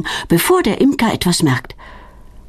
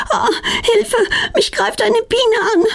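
much higher, crying out in panic; frightened cry for help. A narrator's reading. Girl attacked by a bee in the dark, panicked cry. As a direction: yelp as if a bee is stinging you. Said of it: "Die beiden Mädchen erschrecken sich".

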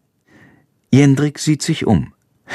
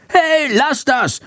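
very high, slow squeal of protest; scolding; slower than usual. A narrator's reading. Bat attacked by two swallows, shrilly scolding. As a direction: squawk it high like an indignant little bat. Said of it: "keift Schandor".

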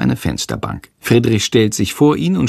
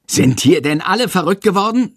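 higher, mildly raised exasperated yell; mock outrage at the whole class; teacher shouting; 9 semitones higher than usual. Teacher shouts at the disruptive boys, exasperated. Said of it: "schreit Herr Lersikow".

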